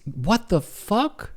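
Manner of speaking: slow, high-pitched incredulous question; baffled disbelief. Real, not acted. Reading an absurd quiz option, real bafflement. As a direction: stretch it out in bewilderment.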